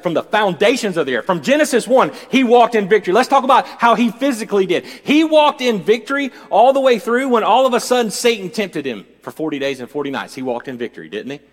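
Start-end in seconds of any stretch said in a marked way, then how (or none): 0.0-8.8 high, loud preaching; loud, rising pulpit proclamation; full-force preaching. Real, not acted. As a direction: drive the sermon home at full voice.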